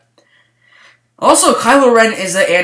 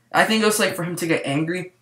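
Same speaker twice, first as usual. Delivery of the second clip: ordinary delivery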